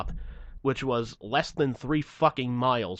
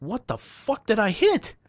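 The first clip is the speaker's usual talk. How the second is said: slow, high and louder, in mock shock; astonished question; 9 semitones higher, 5 dB louder than usual. Driver shown a piece of metal, astonished. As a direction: rise to a baffled peak.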